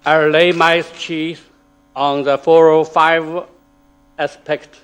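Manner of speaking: ordinary talk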